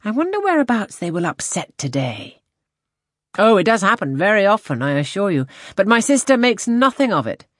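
hearty enthusiasm. A narrator's reading. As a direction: chat away brightly as a hearty captain. Wentworth's cheerful chat in read story.

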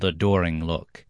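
ordinary delivery

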